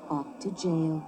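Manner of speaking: plain reading voice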